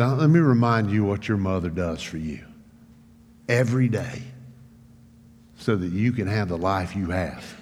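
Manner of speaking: softly spoken, low retold lecture; sober, restrained dad voice; serious father. Father reminding his son of mother's care, quietly serious. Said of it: "then I knew it was serious".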